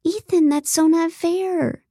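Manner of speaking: high, drawn-out objection; slow, scandalized reproach; shocked protest. A narrator's reading. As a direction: object in shock, pitch jumping up. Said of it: "looked at him in shock".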